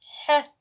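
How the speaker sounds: teacher sounding a letter; ha with sukun. Shown as a demonstration. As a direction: hold ح with no vowel. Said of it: "أقول لها".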